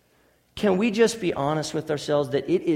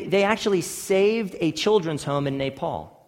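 usual speaking voice

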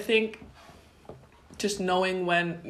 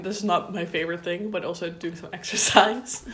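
ordinary delivery

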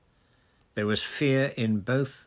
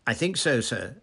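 higher, louder, eager-to-please reply; keen junior clerk, voice lifted; 5 semitones higher, 6 dB louder than usual. A narrator's reading. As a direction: answer your superior keenly. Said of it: "said Percy eagerly".

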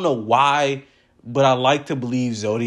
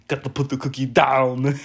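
put-on Austrian action-hero accent, laughing through it; Arnold impression. Showing off his middle-school Arnold impression, Austrian accent with laughter. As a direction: deliver the line like an Austrian action star, cracking up. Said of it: "Like, that impression".

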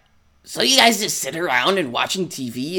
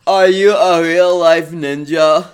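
a louder, slow dopey drawl; Forrest Gump-like Chris; 10 dB louder, slower than usual. Acted. Chris asks Naruto, voiced like Forrest Gump, loud and slow. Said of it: "he's Forrest Gump".

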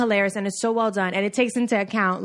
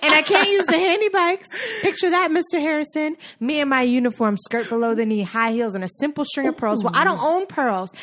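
impassioned, higher-pitched movie outburst; theatrical indignant speech, pitch raised; Hidden Figures monologue; 5 semitones higher than usual. Acted. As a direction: plead your case like a fed-up film heroine. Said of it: "That's from Hidden Figures".

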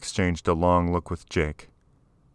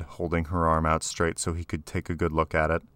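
ordinary delivery, nothing marked

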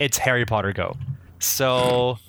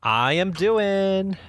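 slow, higher-pitched silly reply; playfully drawn-out; 6 semitones higher, much slower than usual. Pretend. Guest answering how he is, comically drawn-out. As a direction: stretch each word out high.

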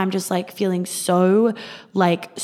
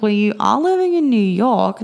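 friend's pointed remark; 3 semitones higher, 5 dB louder than usual. Retold or imitated. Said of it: "And she's like".

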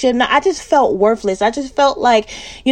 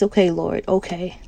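reluctant giving in; 4 semitones lower, 6 dB quieter than usual. Retold or imitated. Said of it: "I just wanted to just".

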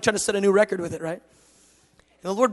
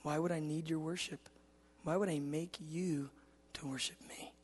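God's quiet challenge; 14 dB quieter than usual. Retold or imitated. God explaining to his son, hushed. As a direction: speak very softly and let the end vanish. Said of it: "He goes".

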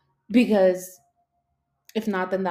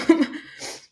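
breathy laugh; 10 semitones higher, 6 dB louder than usual. Real, not acted. Laughs before admitting dislike.

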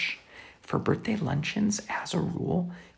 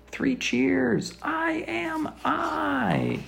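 cheering; 8 semitones higher, much slower than usual. A narrator's reading. Cheering self at sky.

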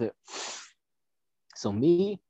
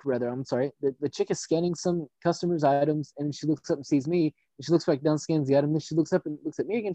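usual speaking voice

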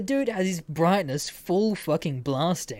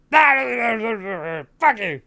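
loud, higher parting yell; shouted insult; 5 semitones higher, 6 dB louder than usual. Retold or imitated. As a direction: yell it as a furious parting shot, imitated. Retold parting yell in cinema.

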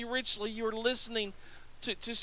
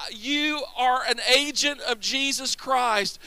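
ordinary talk

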